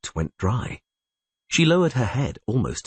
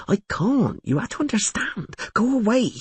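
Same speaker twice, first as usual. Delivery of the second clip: frightened. A narrator's reading. Frightened innkeeper pleads.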